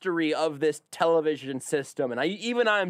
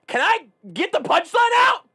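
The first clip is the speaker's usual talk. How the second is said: high, loud outburst; exasperated shout; 14 semitones higher, 7 dB louder than usual. Real, not acted. Host interrupted before his punchline, exasperated shout.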